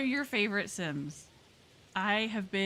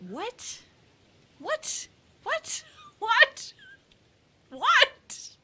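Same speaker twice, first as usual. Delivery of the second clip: much higher, climbing louder squeaks of disbelief; can't believe it, squeak it again and again; incredulous what; 8 dB louder, much slower than usual. Real, not acted. Streamer reacting to the shampoo story, incredulous.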